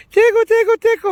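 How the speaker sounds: very high, loud mock tickle voice; imitating nurse. Nurse swabbing the other side, squeaky exaggerated imitation. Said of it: "She does the same thing".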